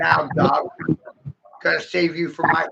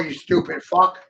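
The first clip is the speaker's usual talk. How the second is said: ordinary talk, nothing marked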